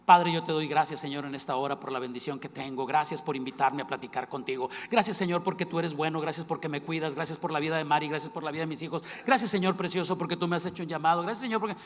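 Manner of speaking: loud, rising praise to God; kneeling prayer poured out loudly; fervent prayer. Retold or imitated. Acts out his usual fervent prayer.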